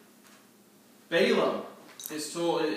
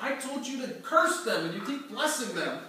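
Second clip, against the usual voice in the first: higher, exasperated and half laughing; frustrated complaint; 8 semitones higher than usual. Acted. Balak complaining to Balaam, exasperated and higher. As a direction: throw up your hands at him. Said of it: "And Balak gets frustrated".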